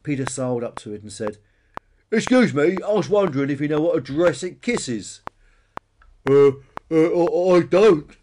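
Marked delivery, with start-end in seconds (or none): 2.1-5.2 loud, raised, cheeky dinosaur voice; bonehead dinosaur Peter. A narrator's reading. Peter accosts the stegosaurus. 6.3-8.2 loud, higher, hesitant dinosaur; munching stegosaurus. A narrator's reading. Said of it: "munched the stegosaurus".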